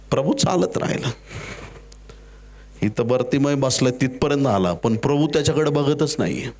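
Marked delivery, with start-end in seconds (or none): none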